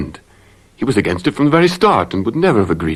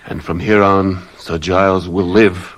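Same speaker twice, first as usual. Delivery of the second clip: usual speaking voice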